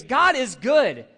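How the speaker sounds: slow, loud, high "God is good"; joyful proclamation. Shown as a demonstration. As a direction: proclaim it with a smile in your voice. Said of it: "without a smile entering your face".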